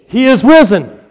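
full-voiced shout. Real, not acted. Preacher leading the Easter acclamation, full-voiced shout. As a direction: shout it out to the whole church. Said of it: "Can you raise your voice this morning".

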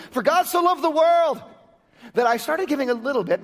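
hammed-up pulpit parody; mock scripture. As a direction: ham up the verse as a hesitant giver. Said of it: "parody of a stingy giver".

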